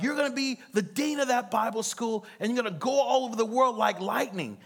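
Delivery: high, ringing prophecy; mock prophetic pronouncement; prophet's proclamation. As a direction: announce it like a prophet on stage. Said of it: "And he's like".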